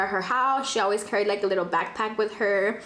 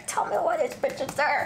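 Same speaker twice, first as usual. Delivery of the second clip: higher, incredulous repeat; exasperated repeat; 4 semitones higher than usual. Shown as a demonstration. Repeating her disbelief that the girl is in the car, higher and incredulous. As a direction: say it again in disbelief. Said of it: "And tell me why this bitch is there".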